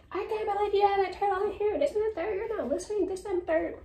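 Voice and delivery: mock high-pitched yelling rant; retold yelling. Woman yelling at tenants about a turtle, mock high yell. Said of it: "she was yelling at us, like, literally yelling".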